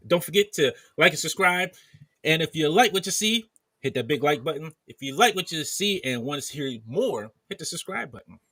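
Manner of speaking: upbeat announcer plug; YouTuber outro. Real, not acted. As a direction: sell the subscribe button.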